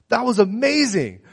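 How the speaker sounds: high, excited amazement; excited awe. Retold or imitated. Brother telling brother what just happened, excited. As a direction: marvel out loud.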